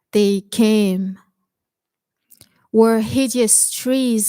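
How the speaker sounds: slow, deliberate verse reading with drawn-out words; poetry recitation. Acted. Poet reading a poem of civil war through a child's eyes, slow recitation. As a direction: recite slowly, image by image, with pauses.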